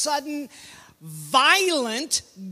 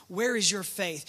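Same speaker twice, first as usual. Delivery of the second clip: low, soft and sober; hushed, reflective question; 5 semitones lower, 8 dB quieter than usual. Retold or imitated. Preacher turning Jesus's question on the congregation, hushed. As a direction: pose it quietly for reflection.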